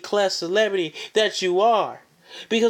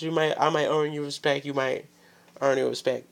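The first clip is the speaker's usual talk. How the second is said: normal talking voice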